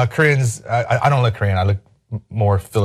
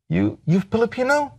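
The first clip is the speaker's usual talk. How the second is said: imitated hairdresser, high and rising; high-voiced puzzled question; incredulous questioning; 8 semitones higher than usual. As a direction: ask it haltingly, pitched well up. Imitates hairdresser tapping him to ask.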